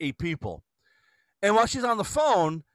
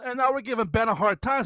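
ordinary talk

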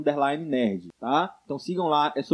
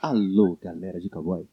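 rodeo announcer; 10 semitones lower, 5 dB quieter than usual. Pretend. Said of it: "Isso é tua coisa acústica?".

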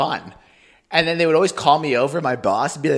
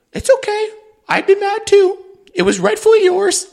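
put-on very high, thin old-lady voice; imitated grandma; 5 dB louder than usual. Grandma consoles her mad grandkid, very high put-on voice. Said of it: "She's like".

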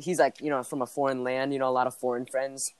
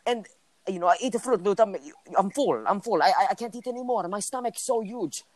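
higher, broken-English imitation of a foreign friend; hammed-up immigrant-kid voice telling his story; friend's foreign accent; 6 semitones higher than usual. Guy imitating his friend from a foreign land telling about cereal, mock accent.

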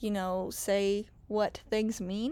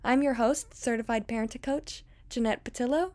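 podcast intro; 2 semitones higher than usual. Acted. Recorded podcast intro over music.

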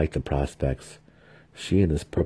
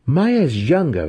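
daring protest; 11 semitones higher, 6 dB louder than usual. A narrator's reading. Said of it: "Vienna dared to protest".

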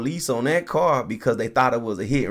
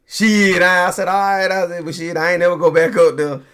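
excited; 7 semitones higher, 8 dB louder than usual. Real, not acted. Laughing punchline of his story.

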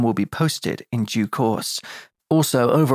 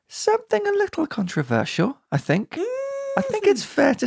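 high teasing voice. Pretend.

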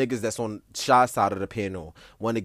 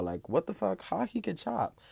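shocked crowd; 9 semitones higher, 5 dB quieter than usual. Retold or imitated. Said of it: "They was like".

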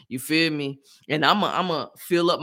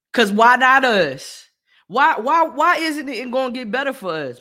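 loud, higher rhetorical shout; loud defiant cry; passionate; 6 semitones higher, 7 dB louder than usual. Real, not acted. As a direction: demand it, fired up.

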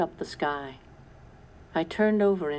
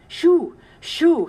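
much higher, loud shooing cries; cry to the cows; 12 semitones higher, 11 dB louder than usual. A narrator's reading. Sarah driving the cows from the barn, loud cries. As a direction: chase the cows out with a yell. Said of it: "she cried".

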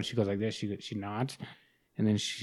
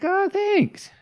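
very high, louder "oh, thanks"; flattered surprise; 5 dB louder than usual. Retold or imitated. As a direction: squeak it out, flattered and caught off guard. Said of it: "I was like".

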